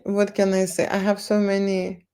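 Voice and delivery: ordinary delivery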